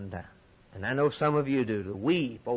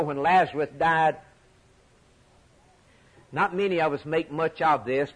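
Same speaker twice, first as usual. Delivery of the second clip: ordinary talk, nothing marked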